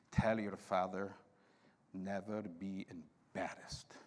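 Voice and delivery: low, slow, near-whispered warning; imitating a priest, sinking into a slow whisper; hushed priest's admonishment. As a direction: lean in quietly and space out the words. Priest's stern message, acted out.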